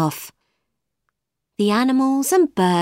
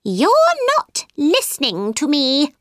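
high-pitched cross mouse voice; angry mouse; 6 semitones higher than usual. A narrator's reading. Mouse to inattentive Alice, angry high voice. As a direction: scold her as an indignant little mouse. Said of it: "he said angrily".

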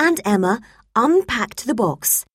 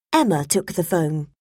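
ordinary delivery, nothing marked

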